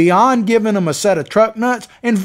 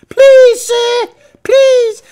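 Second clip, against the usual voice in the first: high, loud whining plea; mock whining beg; 6 dB louder, much slower than usual. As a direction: whine and beg, pitched way up. Senators begging and whining.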